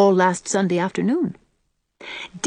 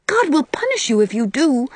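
solemn; 7 semitones higher than usual. A narrator's reading. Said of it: "said Dora solemnly".

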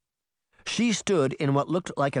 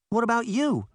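higher, gentle coaxing question; coaxing Daphne; 11 semitones higher than usual. Acted. Girl coaxing the silent mirror to speak. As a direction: coax it out gently like a shy puppy.

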